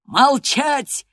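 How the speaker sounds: high, loud command for silence; furious shout. A narrator's reading. Heathcliff silences the defiant girl, shouting. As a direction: shout the order down at them. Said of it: "вскричал Хитклифф".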